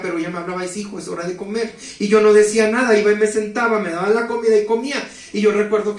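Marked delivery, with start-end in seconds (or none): 0.6-1.7 a quiet, gentle call to come and eat; soft motherly call. Retold or imitated. Mother softly calling her son to eat, quietly. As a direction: coax him softly to the table.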